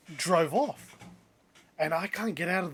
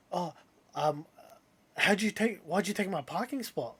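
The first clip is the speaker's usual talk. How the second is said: imitating a flustered driver, higher pitch; hesitate, then blurt it out, mildly flustered; 3 semitones higher than usual. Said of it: "like, flu-, flustered".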